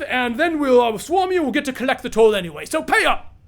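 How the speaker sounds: high, loud mocking bandit voice; bandit taunting. Road bandit taunting the guard from afar, high and jeering. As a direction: sneer it like a smug road bandit. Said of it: "You hear a voice at the distance say".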